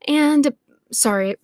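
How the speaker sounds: winded and slowed down; breathless apology; out of breath. Real, not acted. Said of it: "I got out of breath".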